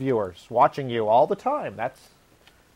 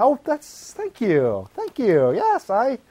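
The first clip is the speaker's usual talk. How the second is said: mock flattered reply; 7 semitones higher, slower than usual. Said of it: "And then I'd respond".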